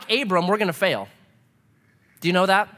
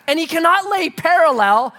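ordinary delivery

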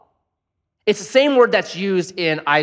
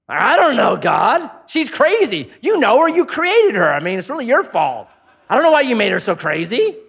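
high, louder panicky Abraham blaming God; flustered excuse; 7 semitones higher, 4 dB louder than usual. Retold or imitated. Abraham caught out by God, flustered and pleading, pitched high. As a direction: squirm out of the question.

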